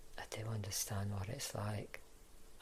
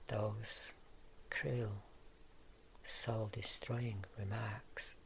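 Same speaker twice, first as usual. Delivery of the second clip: ordinary delivery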